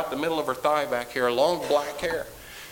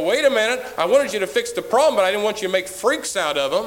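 mock parents; 5 semitones higher, 6 dB louder than usual. Parents complaining, mocked.

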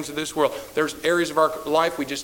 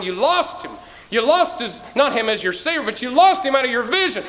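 insistent preaching; 8 semitones higher, 5 dB louder than usual. Acted. Preaching at force.

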